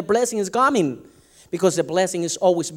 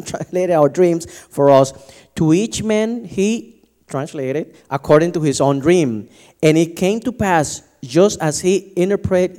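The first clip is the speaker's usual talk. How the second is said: louder, measured Bible reading; lightly formal reading voice; scripture reading; 5 dB louder than usual. Acted. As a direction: proclaim the passage to the church. Reads Genesis aloud.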